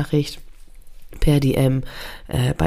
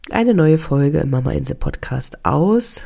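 normal talking voice

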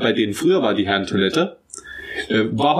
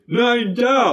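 insistent man; 8 semitones higher than usual. Retold or imitated. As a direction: repeat it stubbornly while pointing.

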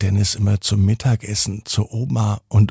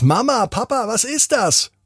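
put-on high child voice calling out; a little boy calling his parents, pitched up; startled boy; 11 semitones higher than usual. A narrator's reading. Dennis calls his parents about the stranger, high startled child voice. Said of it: "fragt Dennis".

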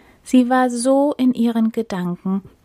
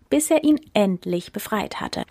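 plain reading voice

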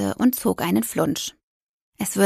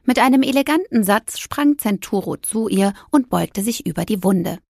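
plain reading voice

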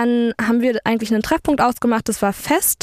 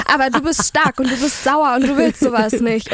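self pep talk; 3 semitones higher than usual. Retold or imitated. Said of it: "denk mir so".

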